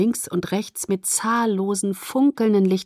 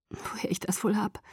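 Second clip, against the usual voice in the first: near-whispered murmur, much softer; quiet; 11 dB quieter than usual. A narrator's reading. Daughter's aside to her dad about where she gets it, said quietly. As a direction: say it under your breath, hushed. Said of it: "sagte ich leise".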